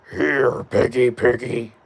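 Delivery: a lightly voiced menacing butcher, slow; Butcher impression. Streamer tries the Butcher voice for a viewer, slow and menacing. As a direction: call the pig slowly like a horror villain. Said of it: "do the Butcher voice impression".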